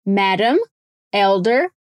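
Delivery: careful "Madam, elder", soft tapped D; soft D drill. Shown as a demonstration. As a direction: say "Madam, elder" as a repeat-after-me drill, D softened. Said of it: "o mesmo som de D mais suave".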